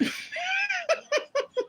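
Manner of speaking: very high squealing laugh. Real, not acted. Woman cracks up at cousin's mukbang remark, high laughter.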